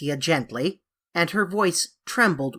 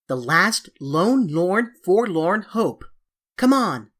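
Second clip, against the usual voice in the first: dramatic; 3 dB louder than usual. A narrator's reading. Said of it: "cried Anthea dramatically".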